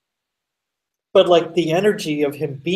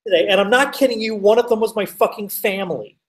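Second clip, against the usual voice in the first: normal talking voice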